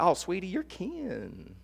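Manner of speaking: high, drawn-out Southern grandma coo. Acted. Preacher imitates a grandma welcoming someone as kin, sugary Southern voice. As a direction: stretch it out sweetly like a Southern granny. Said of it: "if it's like a Southern grandma".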